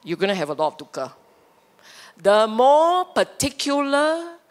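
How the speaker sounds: usual speaking voice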